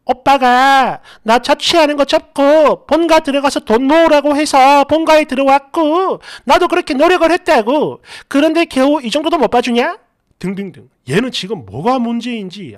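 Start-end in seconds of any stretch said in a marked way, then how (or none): 0.1-10.0 whining girlfriend imitation. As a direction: drag out each clause like an aggrieved girlfriend. Voices girlfriend protesting in quarrel.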